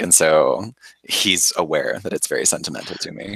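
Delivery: usual speaking voice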